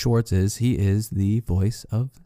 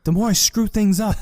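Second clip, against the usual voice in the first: high-pitched, building mock outburst; acted frustration; 10 semitones higher, 3 dB louder than usual. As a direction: cap the tirade in exaggerated frustration. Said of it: "I get so angry".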